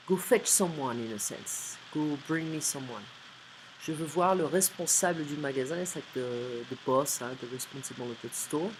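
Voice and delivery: usual speaking voice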